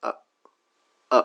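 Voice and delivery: আ as a short weak schwa; schwa demo. Shown as a demonstration. Teacher modelling the schwa. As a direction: say আ very short and light, no pressure. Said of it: "এটা pronounce হবে".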